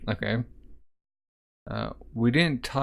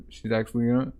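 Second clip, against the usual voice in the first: ordinary talk, nothing marked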